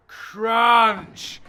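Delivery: loud drawn-out crunch; shouting commentary on the smash; shouted crunch. Real, not acted. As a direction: call the smash out big.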